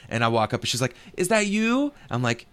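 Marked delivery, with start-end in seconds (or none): 1.1-1.9 high-pitched. Retold or imitated. Voicing the Russian lady.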